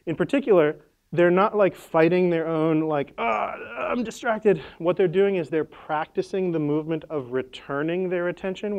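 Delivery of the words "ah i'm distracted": higher, drawn-out groan of distraction; hammed-up, slow frustrated whine; mock frustration. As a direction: act out struggling with distraction, dragged out slowly. Acts out fighting one's own distraction.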